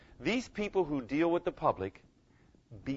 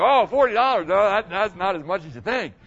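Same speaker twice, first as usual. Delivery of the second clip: higher, louder, scoffing voice; mock indignant outburst; 5 semitones higher, 7 dB louder than usual. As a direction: scoff at what forty dollars buys. Mocking airport prices remark.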